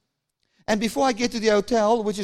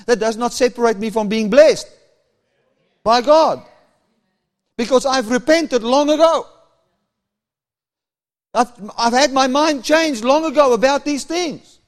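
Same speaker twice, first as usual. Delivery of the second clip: louder, rising sermon delivery; fervent preaching; 3 semitones higher, 6 dB louder than usual. Acted. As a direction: preach it out to the crowd. Preaching builds to full force.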